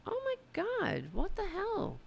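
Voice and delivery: very high-pitched astonished squeal; stunned disbelief. Real, not acted. Stunned by a surprise PayPal gift.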